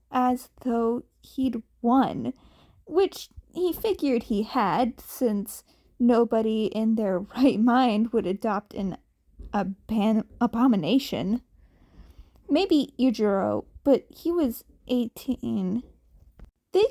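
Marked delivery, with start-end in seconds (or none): none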